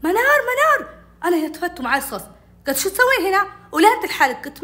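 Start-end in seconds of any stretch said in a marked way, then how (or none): 0.0-0.9 high, loud call of the name. Retold or imitated. Older sister summoning her little sister, calling out. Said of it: "تناديني". 2.8-4.4 raised, louder questioning; scolding questioning. Retold or imitated. Older sister finding the girl alone, scolding questioning.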